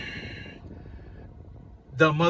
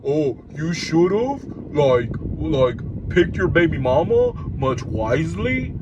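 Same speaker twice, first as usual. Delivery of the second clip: a hint of a know-it-all commenter; mocking commenter; 3 semitones lower than usual. Vlogger mocking a YouTube comment, lightly put-on voice. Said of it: "People put in the comments".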